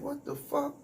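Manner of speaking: high, incredulous what the fuck; squeaky disbelief at a wild remark. Retold or imitated. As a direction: act stunned by what he said. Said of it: "I'm like".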